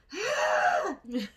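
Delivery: loud, high, sharp gasp; venting gasp. Shown as a demonstration. Getting the anger out, loud gasp. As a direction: burst out with an exasperated breath. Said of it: "I just needed to go".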